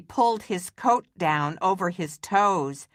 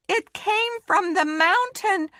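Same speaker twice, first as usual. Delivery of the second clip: much higher, scared cry; frightened cry; 13 semitones higher than usual. Acted. Mitzi crying out at the moan, frightened. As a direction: cry out in fear at the noise. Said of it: "cried Mitzi".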